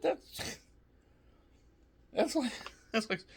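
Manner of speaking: high, laughing stammer; words breaking up in laughter; real laughter. Real, not acted. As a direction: try to start a sentence while cracking up. Laughing at lightly whipping.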